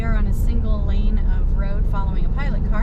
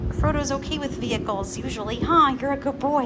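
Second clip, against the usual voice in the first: higher sing-song pet talk; talking to dog; 4 semitones higher than usual. Real, not acted. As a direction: coo at the dog.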